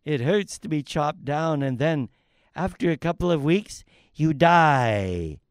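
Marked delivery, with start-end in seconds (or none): none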